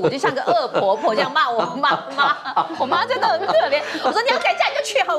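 laughs through the words, high and rushed; laughing. Real, not acted. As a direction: laugh at own harshness. 边笑边自嘲. (边笑边自嘲)

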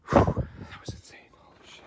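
whispered disbelief after a big exhale; stunned whisper. Real, not acted. Streamer reeling from a huge pull, stunned whisper. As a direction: whisper it, blown away.

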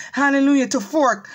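normal talking voice

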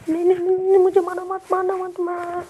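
high, loud frantic plea; panicked begging. Pretend. Gamer begs not to be killed, high and panicked. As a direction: beg for your life at a high pitch.

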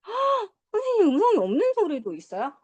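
high gasp of mock surprise; gasp, then ask in put-on amazement; astonished student. Pretend student questioning the teacher, mock astonished.

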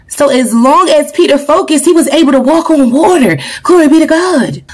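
rising fervent preacher's voice; preaching at full force. Real, not acted. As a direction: preach it home to the congregation.

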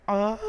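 sudden loud "aah"; startled yell. Real, not acted. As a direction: yelp in alarm at the game. Startled by the game.

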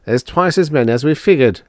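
high, loud dismay; consternation. A narrator's reading. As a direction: blurt it out in alarm. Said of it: "said Drummond in consternation".

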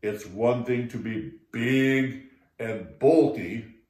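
put-on low, unhurried old toad voice; old toad calmly advising the frogs; wise old toad.

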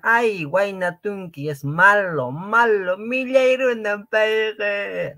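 high, drawn-out mock whining; mock complaint. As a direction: wail it loudly like exaggerated grumbling students. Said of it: "No quiero que digan". (queja fingida, aguda y alargada)

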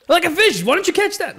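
high, loud mocking yell; excited shout. Real, not acted. Friend yells up high at the fisherman on screen.

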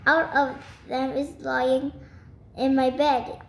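ordinary delivery

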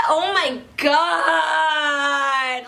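slow stretched cry of disbelief; overwhelmed squeal. Real, not acted. Newly engaged vlogger overwhelmed by her news, squealing slowly. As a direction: let it out like you can't believe it.

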